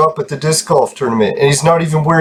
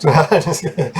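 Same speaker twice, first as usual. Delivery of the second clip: laughing through the words; 4 semitones higher, slower than usual. Real, not acted. Laughing to viewers after teasing rival fans. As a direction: laugh it off as a joke.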